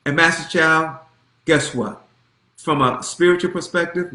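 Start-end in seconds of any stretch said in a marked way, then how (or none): none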